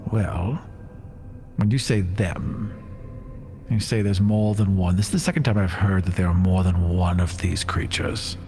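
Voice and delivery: normal talking voice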